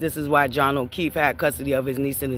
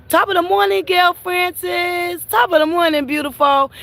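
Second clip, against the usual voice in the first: high, sing-song mock of a cheery TV greeting, much louder; 6 dB louder than usual. Vlogger mocking the interviewer's cheery on-air hello, high and loud. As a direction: ham up the sugary morning hello.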